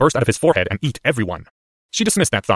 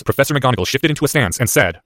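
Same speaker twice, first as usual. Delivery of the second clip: plain reading voice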